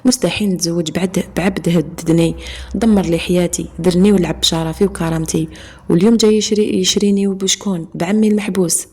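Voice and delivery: ordinary delivery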